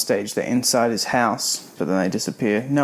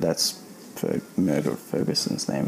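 ordinary delivery

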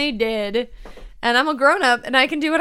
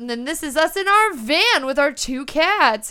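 ordinary delivery

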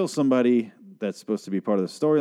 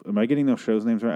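ordinary delivery

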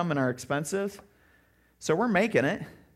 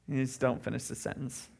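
ordinary talk

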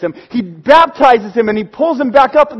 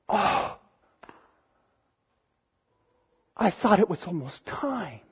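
much softer and lower, deflated; disappointed sigh; 5 semitones lower, 14 dB quieter than usual. Acted. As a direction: let the hope drain out of him. Daniel disappointed by God's answer.

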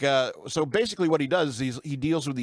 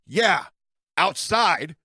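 raised-voice mock scolding retort; higher, punched-out imitation yell, word by word; retold yelling; 5 semitones higher, 5 dB louder, much slower than usual. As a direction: act out an annoyed man's loud comeback. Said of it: "to yell at me for smoking".

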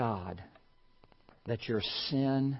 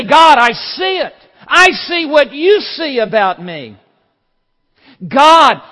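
shouted, much higher, desperate; anguished cry to God; 12 semitones higher, 17 dB louder than usual. Acted. Sinner crying out to God, shouted confession.

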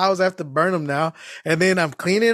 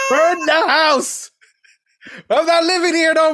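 laughing high repeat; 9 semitones higher, 4 dB louder than usual. Retold or imitated. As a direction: laugh it out, stretched. Same quote repeated laughing.

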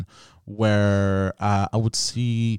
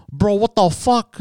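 lightly imitated bro voice, higher and drawn out; bro talk; 8 semitones higher, slower than usual. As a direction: slowly imitate how the guys would talk. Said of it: "this is how they would talk".

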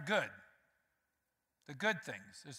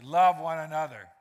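loud, "Love" punched, falling; emphatic; 9 dB louder than usual. Shown as a demonstration. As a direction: declare "Love" to the neighbour. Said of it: "Tell somebody beside you".

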